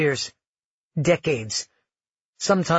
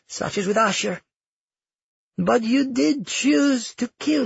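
higher-pitched, deliberate character voice; accusing grandmother; 6 semitones higher than usual. Acted. Grandmother blames her granddaughter for the killings, accusing. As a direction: lay blame with pointed composure.

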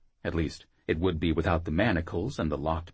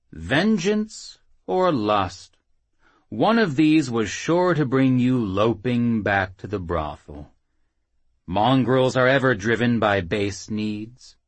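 gloating; 5 semitones higher, 6 dB louder than usual. Acted. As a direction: savour every word. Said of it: "came a gloating voice".

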